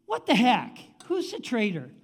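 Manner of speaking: a touch of kingly annoyance, acted; mock exasperation. Enemy king demanding the traitor, mildly exasperated. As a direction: start loud and high, then ease off.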